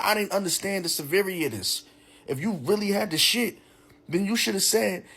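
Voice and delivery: high-pitched, worked-up retold complaint; indignant rant. Man berating date over stink in his new car, high indignant rant. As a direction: scold in a high, exasperated voice.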